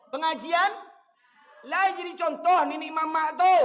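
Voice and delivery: loud and high, railing at the elders; fiery preaching rebuke. Real, not acted. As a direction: thunder at the elders from the pulpit.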